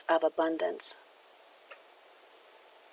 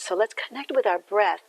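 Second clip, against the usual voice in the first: guided meditation. Acted. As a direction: ease them into breathing with gratitude. Leads a gratitude breathing meditation.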